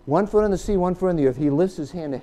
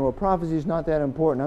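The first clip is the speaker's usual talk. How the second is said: normal talking voice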